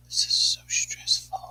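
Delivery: whispered to herself; hushed half-joking complaint. Real, not acted. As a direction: breathe it out under your breath. Muttering to herself.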